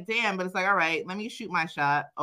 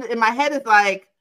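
usual speaking voice

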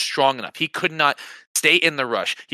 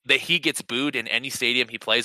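ordinary delivery

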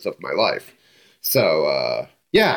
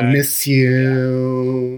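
very slow, higher, louder whining pop-punk voice; full [name] whine; 5 semitones higher, 4 dB louder, much slower than usual. Retold or imitated. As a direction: lean all the way into the whine, stretching every word. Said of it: "you really have to lean in".

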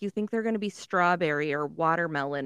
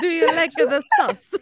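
high, loud cartoon Italian; mock Italian accent; 8 semitones higher, 7 dB louder than usual. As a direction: ham up the Italian lilt. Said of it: "Sounds Italian".

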